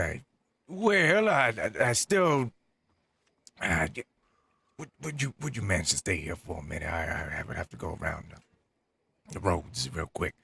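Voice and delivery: ordinary talk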